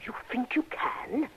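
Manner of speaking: slow, unvoiced whisper of disbelief; awed whisper. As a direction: breathe it out slowly, barely voiced, in wonder. Widow doubts the detective, whispering.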